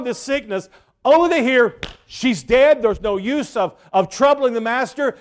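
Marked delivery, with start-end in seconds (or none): none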